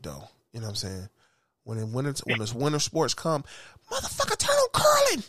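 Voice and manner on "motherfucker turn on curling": high, eager call to switch on curling; excited call. Retold or imitated. Friend urging someone to put curling on, excited call. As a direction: yell it out like the game's starting.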